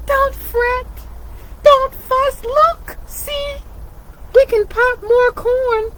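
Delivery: high squeaky little mouse voice; a mouse soothing the upset bear, high and squeaky. Acted. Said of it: "Mouse squeaks".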